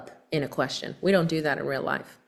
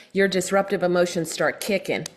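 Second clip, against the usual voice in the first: usual speaking voice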